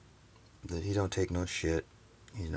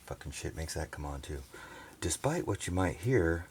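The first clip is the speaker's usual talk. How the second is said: ordinary delivery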